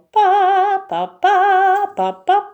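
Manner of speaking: high, loud sing-song "pa, pa"; a bright singsong farewell; playful goodbye. Real, not acted. Cheerful goodbye to the children.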